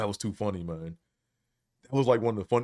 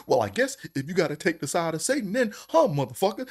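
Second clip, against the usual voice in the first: cussing preacher imitation; 6 semitones higher than usual. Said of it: "He was like".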